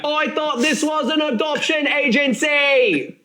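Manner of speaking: high, slow, silly cartoon voice; Esperoba from Yu-Gi-Oh Abridged. Quoting a parody series to co-host, high silly voice. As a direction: put on a high, drawn-out parody boy voice. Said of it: "Yu-Gi-Oh! The Abridged Series, Esperoba".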